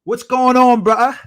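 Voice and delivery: loud, high mock-English greeting; hammed-up bad London accent; terrible English accent. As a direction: belt out a badly faked English accent. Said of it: "that is a terrible accent".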